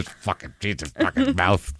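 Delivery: low-volume cursing to oneself; private grumbling. Acted. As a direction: imitate venting under the breath at home. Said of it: "That's what you say at home".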